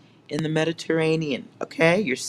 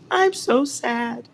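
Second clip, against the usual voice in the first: mock crying. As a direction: fake a teary sob for show. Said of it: "Bubble bath and tears".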